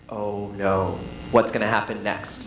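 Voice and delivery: very low, hushed-in-suspense audience thought. Acted. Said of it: "your audience is like".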